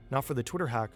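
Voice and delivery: ordinary delivery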